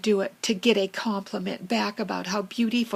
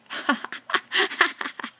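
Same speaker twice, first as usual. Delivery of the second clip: high breathy giggle; a short high laugh; 10 semitones higher than usual. Real, not acted. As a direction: giggle happily at yourself. Giggling about own tail.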